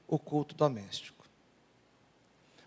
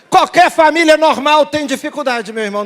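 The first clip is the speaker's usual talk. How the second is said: loud, high pulpit exclamation; raised, insistent preacher's voice; emphatic preaching; 9 semitones higher, 14 dB louder than usual. Real, not acted. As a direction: proclaim it forcefully to the whole congregation.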